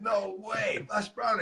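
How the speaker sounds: breathy high no way, Les Brown; hushed, amazed reaction to a famous caller; stunned disbelief. Retold or imitated. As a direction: gasp it out, can't believe it's him. Retold disbelief on the phone.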